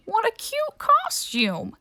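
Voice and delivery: put-on high, slow, sweet old man voice; doting old man. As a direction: coo it out like a charmed old grandpa. Old man greets ghost kid.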